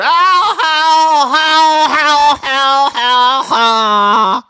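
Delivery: high, loud laughing Louisiana whoop; imitating a Louisiana lady laughing out loud; Louisiana holler. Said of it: "you sound like John Kennedy".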